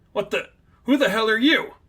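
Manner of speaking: sudden high, alarmed cry; startled outburst. Acted. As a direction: jump at an unseen intruder. Figment startled by unseen attacker.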